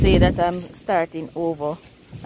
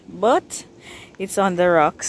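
ordinary talk

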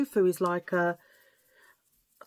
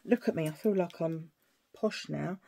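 ordinary delivery

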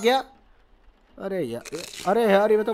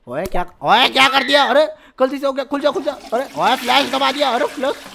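higher-pitched flustered yelp of words; alarmed outburst; 5 semitones higher, 3 dB louder than usual. Real, not acted. Player reacting to the baby mishap, alarmed. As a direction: blurt it out in a sudden panic. Panics at in-game flush mishap.

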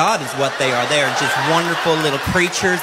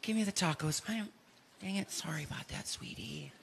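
soothing mom; 18 dB quieter than usual. Retold or imitated. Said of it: "She goes".

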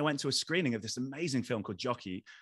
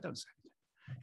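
unvoiced whisper, much softer; whispered don't say anything; whispered warning; 2 semitones higher, 13 dB quieter than usual. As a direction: hush him with a whisper. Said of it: "and he was like".

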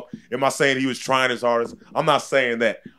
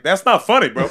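laughing; 9 semitones higher, 6 dB louder than usual. Retold or imitated. Retelling laughing crowd at interview.

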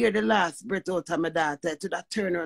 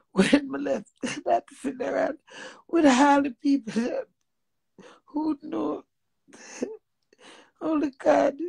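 real sobs breaking up higher-pitched words; crying; 7 semitones higher than usual. Real, not acted. Grieving mother recalling leaving the funeral, crying. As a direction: cry through the words, catching between phrases. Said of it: "me have to cry when the cry come".

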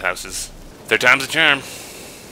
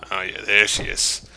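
normal talking voice

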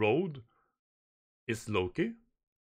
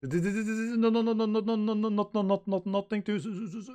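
much higher, stuttering panic; terrified stammer; 8 semitones higher than usual. Acted. Scared crew member stammering about warriors in panic. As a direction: stammer in terror, words stuck.

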